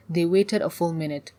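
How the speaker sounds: ordinary delivery, nothing marked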